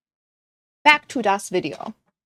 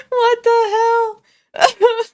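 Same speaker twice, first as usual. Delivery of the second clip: high, slow, squealed through laughter; laughing disbelief; 10 semitones higher, 6 dB louder, much slower than usual. Real, not acted. Viewer reacting to a tearful clip, laughing disbelief. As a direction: wheeze it out, helpless with laughter.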